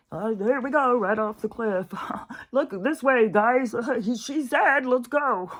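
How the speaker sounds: high, loud cackling taunt, laughing through; mock gleeful herder. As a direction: taunt the lemmings over the cliff, gleefully. Voicing gleeful herders of lemmings.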